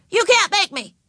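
put-on high little boy yelling back; stubborn kid's high-pitched yell; defiant yell. A narrator's reading. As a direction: dare them in a high childish shout. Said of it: "yelled Howie".

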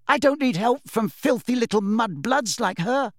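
high, indignant spitting contempt; angry insult. A narrator's reading. Snape rejecting Lily's help, angry scorn.

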